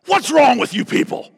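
loud, high incredulous cry; exasperated outcry. Retold or imitated. Pastor quoting Sproul scolding the audience, loud and incredulous. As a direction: fling it out at the whole room in disbelief. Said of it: "He just goes".

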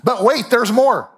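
slow, high, hammed-up pitchman; infomercial voice. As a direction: sell it like a late-night TV ad. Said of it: "This sounds like an infomercial".

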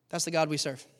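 normal talking voice

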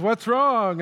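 high, loud retold shout; imitated alarmed call. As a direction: call out in mock concern. Acts worker calling back in alarm.

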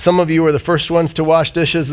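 ordinary talk

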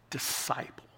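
whispered key word. Real, not acted. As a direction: let "disciple." fall to a breathy whisper.

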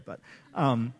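ordinary talk

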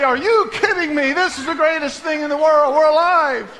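high, loud, amazed cry of delight; mock joyful shout. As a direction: shout it as the overjoyed crowd. Congregation's imagined joyful reply.

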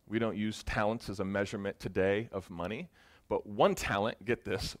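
ordinary talk

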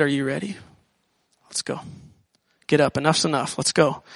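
a low, soft Jesus urging the man up; a hint of a gentle Jesus, low and soft. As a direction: quietly coax him to his feet.

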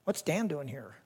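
low, hushed aside to yourself; wary inner thought. Pretend. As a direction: mutter it under your breath as a private thought. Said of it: "would you think to yourself".

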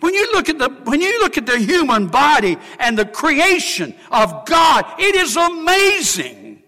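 high, loud preaching in full cry; full-force preaching. Acted. Preacher marvelling at creation before the church: ringing full-voiced preaching. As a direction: proclaim the wonder of creation to the whole church.

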